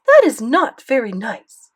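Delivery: put-on loud French-accented reproach; firm, emphatic telling-off; scolding. A narrator's reading. Grandmother reproving grandson's rude remark, scolding.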